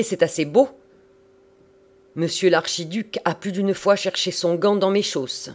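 loud, high, indignant proclamation; thundering voice. A narrator's reading. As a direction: thunder proudly at the usher and the hall. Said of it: "s'écria-t-il avec sa voix de tonnerre". (voix de tonnerre, fière et indignée)

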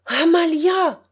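father shouting his wife's name, loud and high; loud calling shout. A narrator's reading. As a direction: call her in from the back room. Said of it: "cria mon père".

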